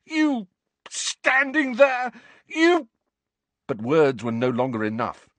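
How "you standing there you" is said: high, loud broken shout; boy too angry to finish; furious sputter. A narrator's reading. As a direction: choke on rage mid-sentence. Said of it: "Harry roared".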